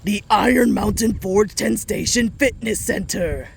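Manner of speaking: mock-grand announcer naming a place; put-on announcer voice. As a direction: proclaim it like an official title, hammed-up. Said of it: "say this in the exact same voice".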